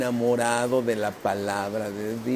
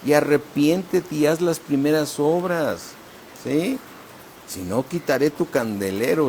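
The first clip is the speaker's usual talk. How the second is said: raised preaching voice; 4 semitones higher than usual. Acted. Preacher calling the church back to its first love, raised and pleading. Preaching God's call to repent.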